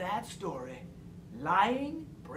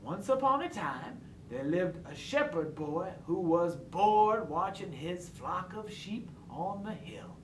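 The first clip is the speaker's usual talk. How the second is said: ordinary delivery, nothing marked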